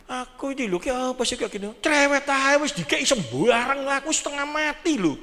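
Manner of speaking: a husband fed up with his wife's nagging; annoyed complaining husband. Retold or imitated. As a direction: whine about your nagging wife. Husband complaining about nagging wife.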